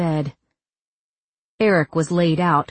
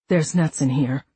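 mouth full; 3 semitones lower than usual. Acted. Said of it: "It sounded like he was speaking through a mouthful of cotton".

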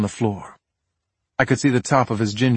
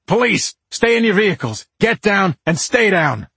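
loud police commands; yelling; 10 semitones higher, 5 dB louder than usual. Acted. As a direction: shout it at the stopped cars.

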